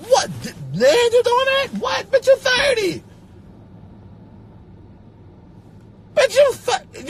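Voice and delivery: very high, loud disbelieving squeal; shrill disbelief. Acted. Mocking a thirty-year-old's new goal, very high loud squeal. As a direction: squeal it in outrage.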